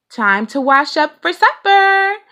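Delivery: loud call up to supper, high and bright. A narrator's reading. Mama calling the family in for supper, loud call. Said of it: "cried Mother Bear".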